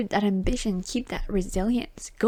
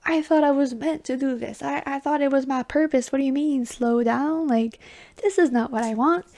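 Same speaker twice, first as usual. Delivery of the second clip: mock disbelief; 4 semitones higher, 5 dB louder than usual. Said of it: "You're like".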